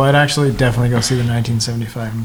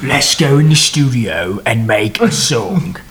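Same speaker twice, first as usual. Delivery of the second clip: mock British accent; slower than usual.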